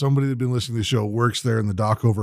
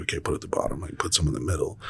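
whispered, barely voiced; mock whisper; 7 semitones lower, 7 dB quieter, faster than usual. Telling a friend how to butter popcorn, whispered. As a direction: breathe the instructions out without voice. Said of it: "and you're like".

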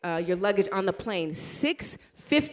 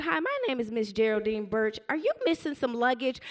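lightly raised, courteous woman on the phone; a touch of higher phone politeness; polite caller voice; 5 semitones higher than usual. Retold or imitated. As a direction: play the helpful airline lady, politely. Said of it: "She said".

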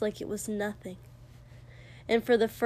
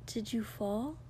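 very soft, careful question; gentle, quiet; 8 dB quieter than usual. A narrator's reading. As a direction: ask gently under your breath. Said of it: "he said gently, quietly".

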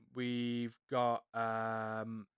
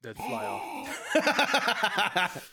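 laughing; 7 semitones higher, 3 dB louder, much slower than usual. Real, not acted. Laughing at crude joke.

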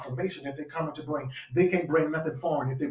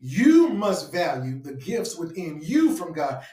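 slow line with "you" stretched and punched; emphatic "you", pitch swooping up then falling; stress on YOU; 3 semitones higher, much slower than usual. Real, not acted. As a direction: lean hard on each "you", drawing it out. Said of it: "I'm emphasizing on the you".

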